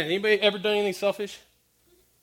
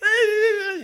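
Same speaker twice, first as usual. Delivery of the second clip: put-on helpless old lady; 13 semitones higher, 6 dB louder than usual. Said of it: "she's like".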